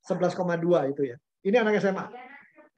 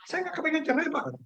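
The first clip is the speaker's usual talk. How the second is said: pleading wife; 9 semitones higher, 4 dB quieter than usual. Retold or imitated. Wife pleading, quoted to counsellor.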